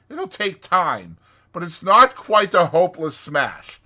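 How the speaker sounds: brisk, scolding Rat voice; sharp. A narrator's reading. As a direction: order Toad about sharply. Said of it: "said the Rat sharply".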